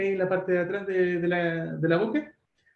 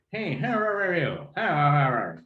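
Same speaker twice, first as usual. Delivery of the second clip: playful imitation of an American, schwa "ah" sounds; mock American accent; slower than usual. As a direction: lightly mimic a gringo with lax schwas. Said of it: "Uno cuando imita a un gringo".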